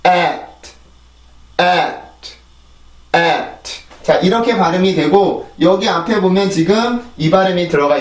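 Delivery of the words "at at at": "at" with pressed æ and released t; falling æ, then t in "at"; adding final T. Shown as a demonstration. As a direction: say "at" slowly apart, each ending in a light t. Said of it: "T 발음이 들어가요".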